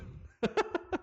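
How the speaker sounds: rire aigu, léger; rire moqueur. Real, not acted. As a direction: ris après ta pique. Rit après avoir envoyé promener Sirdek. (petit éclat de rire aigu)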